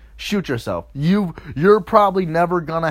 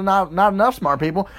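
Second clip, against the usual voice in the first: ordinary talk, nothing marked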